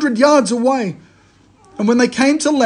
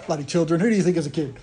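grumbling aside; 4 semitones lower, 7 dB quieter, much faster than usual. Retold or imitated. Disciples' grumbling retort imagined.

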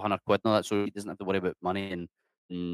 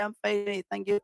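high-pitched, mock-polite answer; acted cheery reply; 11 semitones higher, 4 dB louder than usual. Passer-by answering random greetings from locals, acted high cheery voice. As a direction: play a quick friendly reply, rising to a loud high goodbye. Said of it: "And you're like".

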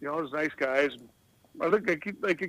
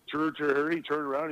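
normal talking voice

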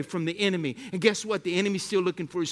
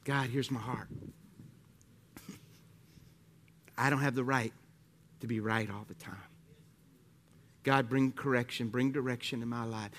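quiet, low, earnest praying; humble prayer; 7 semitones lower, 4 dB quieter than usual. Acted. Pastor leading the church in a humble prayer, low and quiet. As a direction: pray softly from the heart, surrendering.

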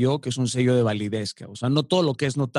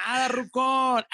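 high, casual buddy reply; street greeting reply; 10 semitones higher, slower than usual. Retold or imitated. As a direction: answer a pal on the street. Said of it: "si vas a la calle".

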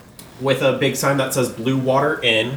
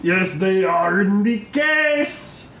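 slow, higher shopkeeper drawl; 5 semitones higher, slower than usual. Acted. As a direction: point to the case in character. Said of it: "Granko looks up and goes".

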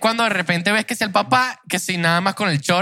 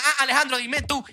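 mock scolding mom; 7 semitones higher, 3 dB quieter than usual. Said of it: "ella gritaba".

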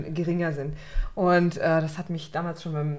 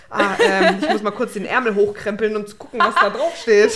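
laughing through an awkward aside; laughing mock mumble; 3 semitones higher, 7 dB louder than usual. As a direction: giggly pretend fumbling. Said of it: "weil ansonsten ist das ein bisschen weird".

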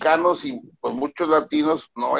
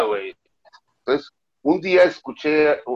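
normal talking voice

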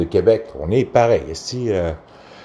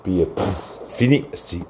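ordinary talk

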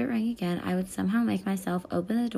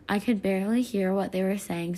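usual speaking voice

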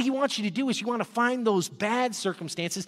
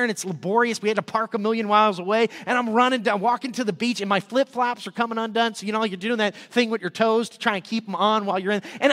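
ordinary talk, nothing marked

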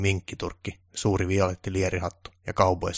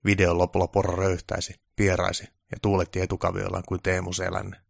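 plain reading voice

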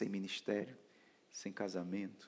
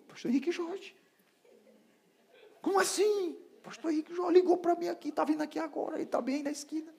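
much higher, hurried excited voice; excited rush; 3 dB louder than usual. Retold or imitated. Husband telling his wife the pastor is near, excited. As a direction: tell her the news breathless with excitement.

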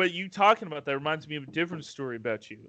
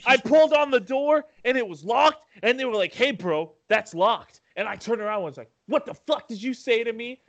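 imitating Michael; 6 semitones higher than usual. Friend mimics Michael retelling the bingo-bar door fight, mock swagger. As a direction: put on his bro swagger, pitched up. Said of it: "I'm gonna tell exactly how he says it".

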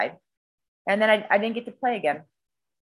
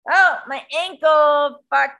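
mock pain; 6 semitones higher, 5 dB louder, slower than usual. Reliving the ankle injury.

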